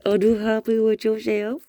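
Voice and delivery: high, loud playful puppy talk. Real, not acted. Laughing baby talk to dog.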